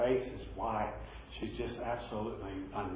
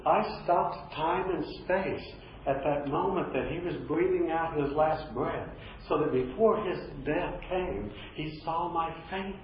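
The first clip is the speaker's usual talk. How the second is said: higher, slow, solemn voice of Jesus; 7 semitones higher, slower than usual. Retold or imitated. Pastor voicing Jesus to the grieving daughter, slow and solemn. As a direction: lay each word down slowly, with awe. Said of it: "He says".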